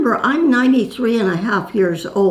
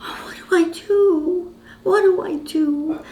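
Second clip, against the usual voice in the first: much higher, imitated helpless panic; 9 semitones higher than usual. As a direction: plead like someone lost and frightened. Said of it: "she was really serious about it".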